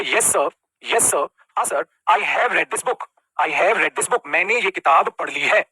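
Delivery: slow, spelled-out student reply; slow model answer. Shown as a demonstration. Teacher slowly modelling a student's answer to him. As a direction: dictate the answer word by word. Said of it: "teacher models student's reply slowly".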